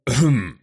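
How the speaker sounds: sudden loud throat clearing; choking cough. Acted. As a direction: choke on air, then cough. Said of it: "поперхнулся воздухом воитель".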